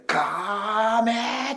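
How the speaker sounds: slow, drawn-out high call; kid's kamehameha. Retold or imitated. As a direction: stretch it like a kid charging a beam. Kid starting kamehameha.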